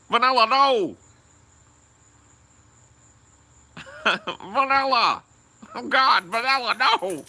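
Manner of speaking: high, loud, hammed-up begging cry; mock pleading scream. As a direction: beg for mercy in a hammed-up scream. Mimics victim begging Vanilla.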